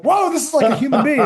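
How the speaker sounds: high, loud amazed exclaim. Retold or imitated. Graduate stunned that his captor is human, amazed. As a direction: react as if stunned by a surprise. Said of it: "I'm like".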